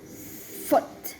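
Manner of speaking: foot whispered, F hissed; whispered practice foot. Shown as a demonstration. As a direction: breathe the word foot. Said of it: "try the A one beat".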